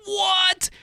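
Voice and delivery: very high, stretched out what; shocked what. Retold or imitated. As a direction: gasp it in disbelief. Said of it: "I'm like".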